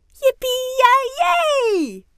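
very high, loud, jubilant cheer; called back. A narrator's reading. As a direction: whoop with delight. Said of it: "roept Fien terug". (juichend teruggeroepen)

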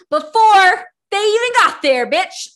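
high, very loud outburst; outraged rant. Real, not acted. Podcaster ranting about the related couple, outraged yell.

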